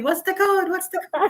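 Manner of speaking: high-pitched mock yell, no real volume; retold yelling. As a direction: re-enact yelling in a panic over the alarm. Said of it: "I'm yelling".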